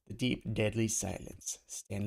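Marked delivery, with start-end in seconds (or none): none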